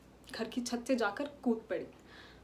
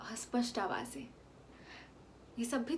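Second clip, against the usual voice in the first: whisper; 7 dB quieter than usual. Acted. Distant hospital voices imagined.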